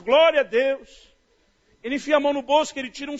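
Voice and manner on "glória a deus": loud, slow, stretched praise; drawn-out praise. Retold or imitated. Preacher staring at the cash, slow loud praise. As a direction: stretch the praise out, louder and slower. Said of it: "eu olho aquele maço".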